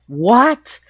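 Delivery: high, loud yell swooping upward; yelled what. Retold or imitated. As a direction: re-enact a shouted, disbelieving question. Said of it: "I yelled the word".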